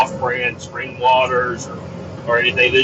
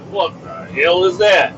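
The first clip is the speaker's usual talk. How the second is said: slow, drawn-out disbelief; disgusted question; 3 semitones higher than usual. Acted. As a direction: stretch out the question in disgust, not shouting. First take of disgusted question.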